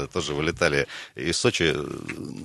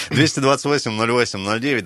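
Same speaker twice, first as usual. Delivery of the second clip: announcer read; 6 semitones higher, 4 dB louder than usual. Acted. Radio host reads phone number.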